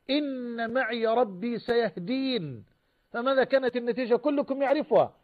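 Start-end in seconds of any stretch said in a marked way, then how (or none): 0.1-2.6 slow, drawn-out, softened recitation; scriptural quotation. Retold or imitated. Preacher quoting Moses' answer to his people, slow scriptural quotation. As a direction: stretch the long syllables with measured assurance.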